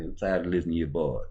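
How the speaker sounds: ordinary talk, nothing marked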